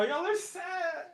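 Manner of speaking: drifting away from the mic; said while walking off from the mic; pacing near and far. Real, not acted.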